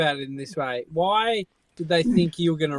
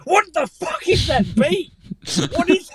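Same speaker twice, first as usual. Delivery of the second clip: high, slow, outraged yell, imitated; imitating a furious caller who hears a beep; angry guy; 14 semitones higher, 3 dB louder, slower than usual. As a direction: act out his fury over the beep, pitched way up. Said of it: "He was so angry".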